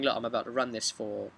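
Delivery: ordinary delivery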